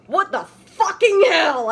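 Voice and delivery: loud, angry demand. Acted. Girl confronting Draco, loud angry demand. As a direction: bark it out in outrage. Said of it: "I asked angrily".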